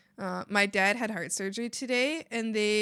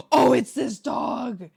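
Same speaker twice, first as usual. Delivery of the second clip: excited shout; 8 dB louder, slower than usual. Real, not acted. Excited reveal of viral dog.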